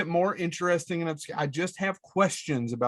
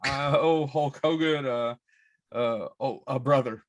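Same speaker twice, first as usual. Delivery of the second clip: Hulk Hogan voice; 5 semitones lower than usual. Pretend. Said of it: "three more bars of that".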